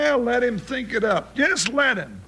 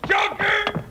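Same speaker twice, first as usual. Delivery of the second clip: calling out; 7 semitones higher than usual. Acted. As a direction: holler for the horse. Calling for his horse outdoors.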